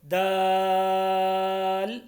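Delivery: दाल with a very long, level aa; greatly lengthened. Shown as a demonstration. Teacher shows three to five alifs, दाल stretched long. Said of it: "तीन से पाँच अलिफ के बराबर लंबा करके".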